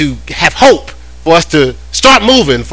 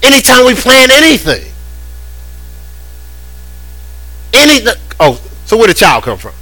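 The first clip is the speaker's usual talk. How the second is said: full-force preaching; 8 semitones higher than usual. Acted. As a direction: preach it up high to the congregation.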